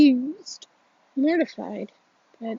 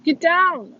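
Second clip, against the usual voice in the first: high urgent shout, louder on the second; crying out; 6 semitones higher, 3 dB louder, slower than usual. Acted. Warning his friend to get down, shouting. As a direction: yell a warning before it's too late. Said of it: "I cry, I cry".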